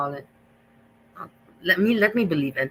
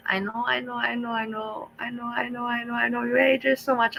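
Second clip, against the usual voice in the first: lightly voiced sing-song mock of the mukbanger, higher, softer; a high, sugary parody of her, softer; mocking her fake sweetness; 6 semitones higher, 3 dB quieter than usual. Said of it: "Now she starts to behave".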